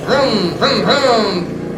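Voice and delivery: high mouthed motorcycle revving; drawn-out "vroom" noises; imitated engine revs.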